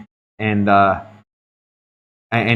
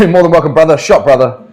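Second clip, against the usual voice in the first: excited thanks; 5 semitones higher, 6 dB louder than usual. Real, not acted. Thanking gifters with a laugh.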